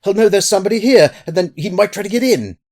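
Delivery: high, loud, urgent panic; frightened outburst, pitched right up; alarmed cry. A narrator's reading. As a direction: cry out to stop him at once. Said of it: "he cried out".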